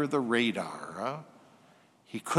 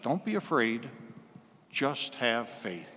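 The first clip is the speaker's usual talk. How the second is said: gentle reassurance; 3 semitones lower, 7 dB quieter than usual. Acted. Jesus reassures the grieving father.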